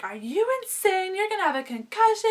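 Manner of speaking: high-pitched scandalized mother; imitated alarmed mom. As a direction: mimic mom freaking out, voice way up. Mom scolds her on phone.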